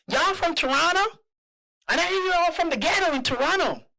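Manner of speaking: put-on high, loud Southern lady's accent; Atlanta, Georgia accent. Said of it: "I'm gonna try to do the accent for you guys".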